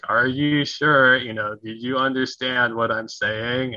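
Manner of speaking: slow, over-careful words as to a foreigner; talking slowly down. Retold or imitated. People talking down to him as if he can't understand English, slow and patronizing. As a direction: stretch each word out condescendingly. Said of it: "they talk slowly to me".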